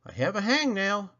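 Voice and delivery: plain reading voice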